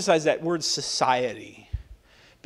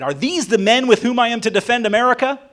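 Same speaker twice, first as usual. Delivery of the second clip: raised, higher mock outraged shout; retold shout; 6 semitones higher, 5 dB louder than usual. Washington to his fleeing militia, a retold angry shout. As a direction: bellow it like a general betrayed by his troops. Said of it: "he shouted".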